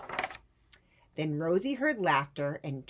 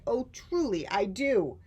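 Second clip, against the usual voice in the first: loud, high hoot of glee; hooting delight; 6 semitones higher, 6 dB louder than usual. Acted. Fred hooting loudly at Rosie's cheese hat, gleeful. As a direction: whoop it out, thrilled. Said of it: "Fred hooted".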